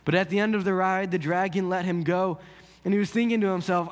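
ordinary talk

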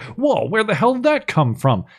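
high-pitched imitation of startled bafflement; mock astonishment. Reviewer voicing the crew as torpedoes appear, mock astonished. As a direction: blurt it as a hammed-up shocked reaction.

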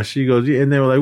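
usual speaking voice

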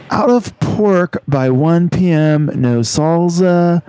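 high, slow laid-back surfer dude; lazy beach bro complaint. Acted. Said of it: "a beach bro".

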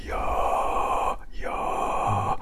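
low, open-throat voiceless whisper; chiaroscuro whisper. Shown as a demonstration. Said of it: "I want them to do".

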